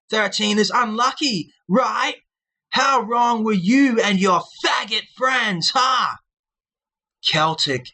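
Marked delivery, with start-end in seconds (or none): 0.1-6.2 high, slow, sneering mock villain; maniacal villain voice. Vlogger mocking critics in a maniacal villain voice. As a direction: cackle down at the commenters from far above. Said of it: "when I laugh at anybody's comments maniacally, like this".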